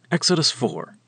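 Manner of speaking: usual speaking voice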